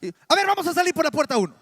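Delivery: sudden loud fast alarm call; mock fire-alarm shout. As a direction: startle them like an emergency exit call. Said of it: "Ya desperté a más de uno". (grito de alarma fingido, fuerte y rápido)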